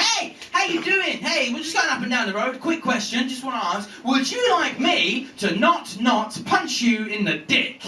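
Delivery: high, breezy doorstep sales patter, stretched at the question; salesman. Retold or imitated. Salesman at the door asking a homeowner a quick question, chirpy mock pitch.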